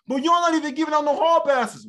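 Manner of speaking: loud, high-pitched mock outrage; indignant protest. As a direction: imitate an aggrieved complainer. Acted protest in imagined interview.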